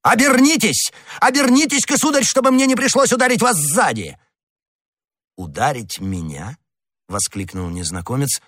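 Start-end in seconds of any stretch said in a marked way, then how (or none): 0.0-4.2 high-pitched, urgent challenge; shouting after him. A narrator's reading. Gascon chasing his offender with drawn sword, shouting. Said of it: "крича ему вслед". 5.4-6.6 soft, low, scornful question; astonished contempt. A narrator's reading. Stranger turning on his heel to the youth, contemptuous surprise. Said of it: "столь же удивлённо, сколь и презрительно".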